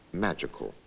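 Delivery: ordinary delivery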